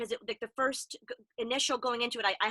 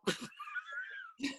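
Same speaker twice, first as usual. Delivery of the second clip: squealing burst of laughter; high squealing laugh; 10 dB quieter than usual. Real, not acted. Laughter after robot bit.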